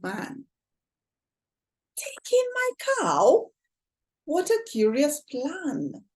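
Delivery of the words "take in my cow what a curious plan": incredulous. Acted. Old lady doubts the advice.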